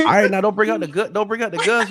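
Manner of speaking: high, loud teasing objection; playful protest. Real, not acted. Host teasing guest not to bring out the guns, playful high protest.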